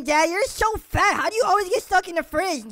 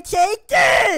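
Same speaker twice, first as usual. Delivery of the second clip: attack shout; 3 semitones higher, 5 dB louder than usual. Acted. Attack in game fight.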